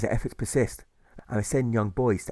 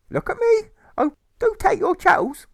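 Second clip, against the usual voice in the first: much higher, loud hammed-up crying; mock sobbing plea; 8 dB louder than usual. Mother pleading with Alfred, hammed-up sobbing. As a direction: wail it melodramatically.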